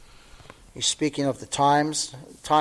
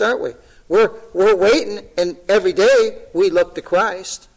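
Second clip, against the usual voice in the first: higher and louder, rising to full force; fervent preaching; 4 semitones higher, 6 dB louder than usual. Acted. Pastor urging his church to look for Christ, fervent preaching. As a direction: press the point home to the flock.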